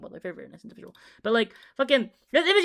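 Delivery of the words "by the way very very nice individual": breathy whispered aside, much softer; whispered repeat. Real, not acted. Whispering the compliment again to the viewers.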